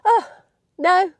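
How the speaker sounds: usual speaking voice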